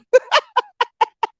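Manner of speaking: high, giggly laugh; amused laughter. Real, not acted. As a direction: crack up after the punchline. Laughs at own joke.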